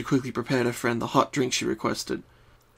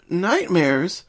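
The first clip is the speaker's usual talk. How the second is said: slightly higher, musing question; thoughtful; 5 semitones higher, 3 dB louder than usual. Acted. Twilight muses on Pinkie's nightmares, thoughtful. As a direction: ponder the word. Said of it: "tapping her chin".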